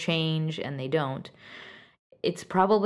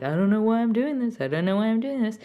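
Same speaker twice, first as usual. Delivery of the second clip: helpless whining; 5 semitones higher, faster than usual. Retold or imitated. Advising listeners, mocking their own helpless excuse in a higher whine. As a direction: imitate someone helplessly giving in, whiny and pitched up. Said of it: "acquiescing and keep saying".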